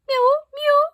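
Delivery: high, loud cat mew; shrill plaintive meows. A narrator's reading. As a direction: mew like an impatient cat at the door. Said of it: "interrupted Simpkins".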